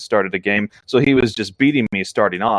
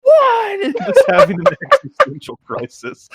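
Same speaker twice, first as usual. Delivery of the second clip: much higher and louder, hammed-up panic through laughter; mock panicked wail; 6 dB louder than usual. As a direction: wail it like a pretend breakdown, laughing. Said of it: "imagining you ... collapsing in the fetal position".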